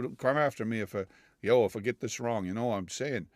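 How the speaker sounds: ordinary talk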